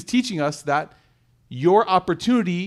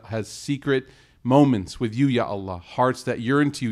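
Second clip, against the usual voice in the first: heartfelt dua; 5 semitones lower than usual. Real, not acted. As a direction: plead humbly and earnestly for the gathering. Leads closing dua.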